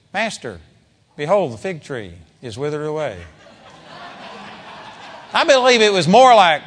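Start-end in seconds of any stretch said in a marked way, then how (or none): none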